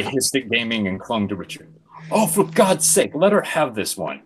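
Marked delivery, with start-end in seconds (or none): 2.1-4.2 groaning. Acted. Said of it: "Jay groaned".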